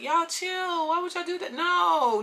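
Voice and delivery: high, drawn-out appeal; pleading protest. Retold or imitated. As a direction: beg the group to back off. Acted breaking up group argument.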